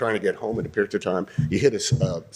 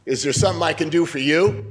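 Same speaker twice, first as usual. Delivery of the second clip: higher, louder, sharp challenge; hostile jab; 5 semitones higher, 5 dB louder than usual. Shown as a demonstration. Shop owner who hates the customer, hostile and louder. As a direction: hit them like a slap. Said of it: "add just a tiny bit of moment".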